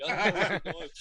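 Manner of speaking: laughing through a joking quote. Retold or imitated. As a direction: play your old self, half laughing. Retold creeper-mode quote, laughing.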